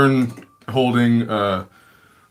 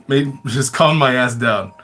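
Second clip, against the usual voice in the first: ordinary talk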